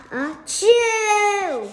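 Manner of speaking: hammed-up sneeze, high and loud; fake sneeze. Child acting out the elephant's sneeze.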